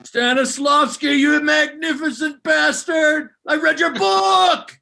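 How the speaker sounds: high, loud, slow shouted tribute; Patton impression. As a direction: bellow it like a general. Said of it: "That was Patton".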